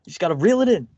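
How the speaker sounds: high, pumped-up mock coaching; hammed-up encouraging counselor. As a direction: egg him on like a proud coach. Voicing the counselor coaching him.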